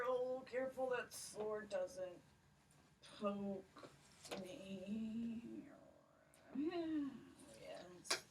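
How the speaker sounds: sleepy giant trailing into a whisper; drowsy giant. Acted. Giant settles down for a nap, sleepy and fading.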